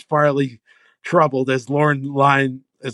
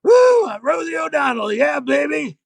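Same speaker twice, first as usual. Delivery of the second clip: Farley yelling as Rosie; 13 semitones higher than usual. Retold or imitated. Writer acting Farley bursting out as Rosie, yelling. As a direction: whoop it like a bad Rosie impression. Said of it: "Chris Farley yelling, no impression at all".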